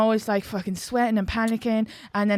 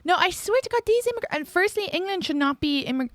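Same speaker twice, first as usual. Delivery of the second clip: indignant; 7 semitones higher than usual. Real, not acted. Indignant about English immigration questioning her.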